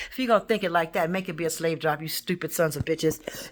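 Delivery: ordinary talk, nothing marked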